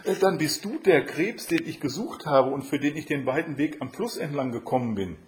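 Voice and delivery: high, surprised storybook heroine; Katja. A narrator's reading. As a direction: realise it aloud, starting high and hesitant. Katja recognises the crab.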